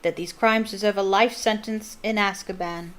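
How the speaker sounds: loud, cold courtroom call; stern judge. Acted. Crouch addressing the jury.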